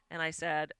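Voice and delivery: ordinary talk, nothing marked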